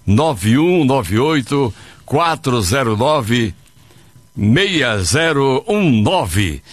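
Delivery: slow, clearly spaced digits; slow number read. Real, not acted. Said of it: "o zap da Nativa". (ditado devagar dos números)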